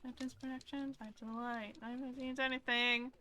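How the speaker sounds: ordinary talk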